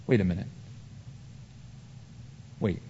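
ordinary talk

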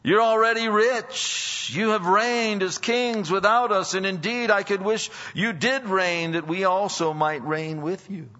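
drip sarcasm on every compliment; preacher voicing Paul's sarcasm at proud church; sarcastic. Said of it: "he begins this kind of sarcastic".

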